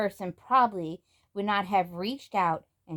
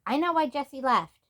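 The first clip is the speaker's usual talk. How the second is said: louder, higher sudden outburst; blurted; 7 semitones higher, 5 dB louder than usual. Acted. Samantha suddenly blurts a secret to Grandmary, louder. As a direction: burst out before thinking. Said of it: "Samantha blurted out".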